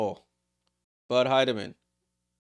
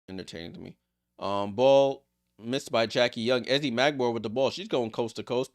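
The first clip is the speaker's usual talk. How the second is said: usual speaking voice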